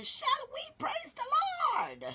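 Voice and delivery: put-on very high, thin child's squeal; tiny high-pitched church cry; squeaky little-girl voice. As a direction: squeal it like a small girl caught up in the spirit. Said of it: "me shout my little voice".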